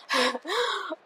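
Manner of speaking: short light chuckle; a brief, quiet laugh. Real, not acted. Laughs while telling the story.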